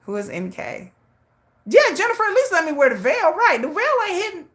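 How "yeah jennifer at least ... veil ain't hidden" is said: excited higher plea; pleading. Real, not acted. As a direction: coax the friend into allowing the veil. Pleading to absent friend.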